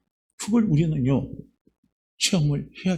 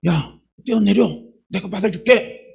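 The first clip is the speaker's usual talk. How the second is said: father calling up; 6 semitones higher, 5 dB louder than usual. Retold or imitated. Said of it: "어떤 한 사람이 나와서".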